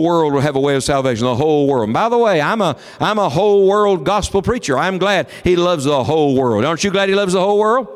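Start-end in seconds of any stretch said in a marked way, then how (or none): none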